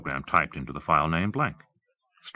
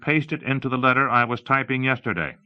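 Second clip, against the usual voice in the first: higher-pitched, emphatic command; lightly voiced demonstrator; 7 semitones higher, 4 dB louder than usual. Giving the computer a command, emphatic presenter voice. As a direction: dictate it clearly to a machine.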